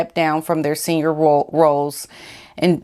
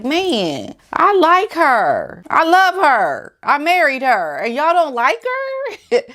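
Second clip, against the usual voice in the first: imitating hurt Harry; 8 semitones higher than usual. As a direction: whine it like a wounded husband. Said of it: "because you like".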